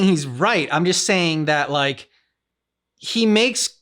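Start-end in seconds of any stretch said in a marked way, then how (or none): none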